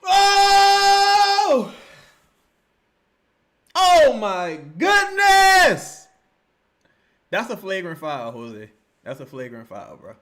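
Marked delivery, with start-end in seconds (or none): 0.0-1.7 long, high, loud "oh"; sustained scream of dismay; shocked howl. Real, not acted. Shocked watching the replay. 3.8-6.1 very slow, high, loud wail; full-voiced cry of disbelief; appalled yell. Real, not acted. Shocked watching the replay.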